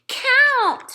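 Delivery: put-on high, loud toddler shout. A narrator's reading. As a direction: yell it like a bossy kid. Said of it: "Fudge shouted".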